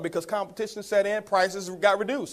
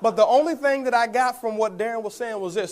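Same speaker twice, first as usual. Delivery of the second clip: usual speaking voice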